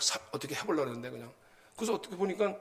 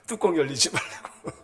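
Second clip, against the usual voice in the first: laughing; 4 dB louder than usual. Real, not acted. Joking about losing one's temper.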